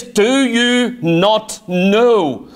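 "Do you not know?" drawn out very slowly, stress on "Do"; slow the question right down; solemn, deliberate rhetorical question; slow emphatic question. Shown as a demonstration. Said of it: "To know is with the mind".

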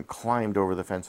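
plain reading voice

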